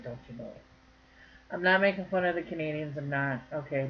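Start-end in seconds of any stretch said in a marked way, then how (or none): 0.0-0.6 low, soft mock-Canadian aside; teasing Canadian accent, dropped low. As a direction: playfully put on a hushed Canadian accent. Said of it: "they really have the Canadian accent".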